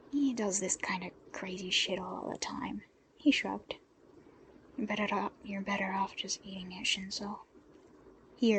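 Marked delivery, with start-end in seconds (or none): 0.1-2.8 low unvoiced mutter to her; a hushed, weary aside. Dobby's gruff aside in whisper. 4.8-7.4 soft, breathy coaxing; murmured encouragement across the table; hushed urging. Acted. Urging the woman quietly.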